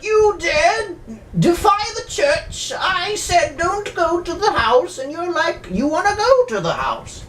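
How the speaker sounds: high, slow mock indignation; stern church official. Church official scolds the priest for going to the house, high mock indignation. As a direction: ham up an outraged church superior. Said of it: "the one guy's like".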